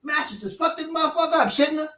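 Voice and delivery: cocky threatening dude. Retold or imitated. Dude threatening old man.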